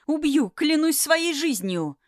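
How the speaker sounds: high, loud furious vow; furious shout. Acted. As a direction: scream the threat at him. Принцесса в ярости. (яростный крик)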